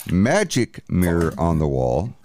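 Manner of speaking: a hint of the Wicked Queen; Wicked Queen quote. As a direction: deliver like the vain queen. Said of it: "the Wicked Queen says".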